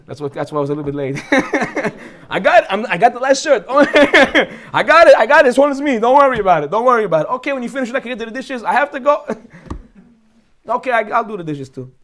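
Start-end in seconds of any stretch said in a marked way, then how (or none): 1.3-7.3 laughing. Pretend. Laughing, doing laundry at home.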